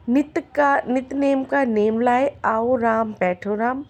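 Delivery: ordinary delivery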